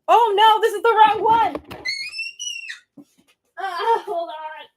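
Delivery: high, slow, drawn-out panicked wail; real panic. Real, not acted. Streamer panicking over dying phone, high drawn-out wail. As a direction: wail it as the phone is about to die.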